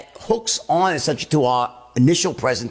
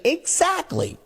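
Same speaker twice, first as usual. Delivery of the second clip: mirroring their certainty; 6 semitones higher than usual. Shown as a demonstration. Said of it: "mirror back their own level of certainty".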